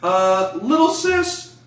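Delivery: high, loud and slow, half-laughing; a mother calling out to the daughter she suspects; suspicious matriarch. Acted. GM voicing Sheba catching intruders, high and loud.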